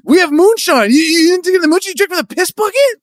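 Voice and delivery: high incredulous shout; shocked yell. Acted. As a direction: yell it in disbelief. Shocked brother yelling.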